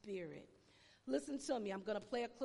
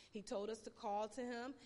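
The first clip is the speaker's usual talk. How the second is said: ordinary talk, nothing marked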